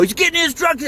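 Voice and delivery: high, sneering guard on the radio; mock the guard calling it in, way up high. Vlogger mocking the guard on the radio, sneering high. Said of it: "acted like I just robbed a bank".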